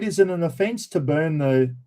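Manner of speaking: usual speaking voice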